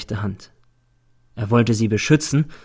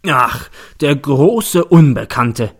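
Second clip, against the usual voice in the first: slow, louder scoffing mockery; mocking; 3 semitones higher, 6 dB louder, much slower than usual. A narrator's reading. Prosecutor scoffs at the unknown boy, slow louder mockery. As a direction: stretch it out to ridicule her. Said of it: "spöttelt der Staatsanwalt".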